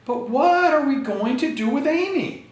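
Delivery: high, fretting mom; worried mother. Acted. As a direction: wail it like a frantic mother. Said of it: "said her mother".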